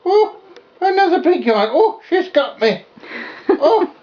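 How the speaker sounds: high, cooing puppy voice; high baby talk. Acted. Puppy chewing her finger.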